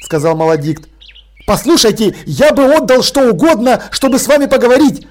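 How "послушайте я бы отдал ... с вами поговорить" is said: eager, fast, raised voice; pleading exclamation; exclaiming eagerly. A narrator's reading. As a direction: blurt it out in a rush. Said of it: "воскликнул Деслов".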